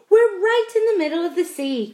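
high, loud exclamation; alarmed cry. A narrator's reading. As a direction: cry out the startling news. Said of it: "cried James".

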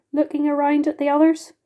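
usual speaking voice

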